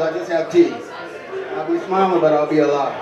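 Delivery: ordinary talk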